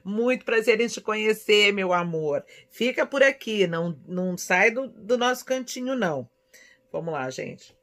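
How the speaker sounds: ordinary delivery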